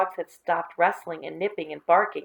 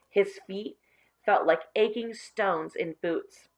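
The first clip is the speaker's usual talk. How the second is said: plain reading voice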